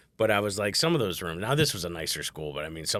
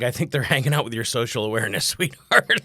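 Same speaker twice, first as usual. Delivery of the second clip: sarcastic dad reply; 3 semitones higher, 7 dB louder than usual. Retold or imitated. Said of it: "I'm like".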